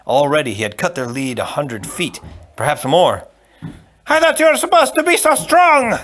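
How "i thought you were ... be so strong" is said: very high, loud scolding; furious shout. Acted. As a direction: yell it down at the giant. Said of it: "the Sicilian shouted".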